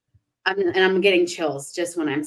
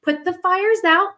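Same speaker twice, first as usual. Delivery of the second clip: frantic reassurance; 8 semitones higher than usual. Retold or imitated. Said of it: "you're like".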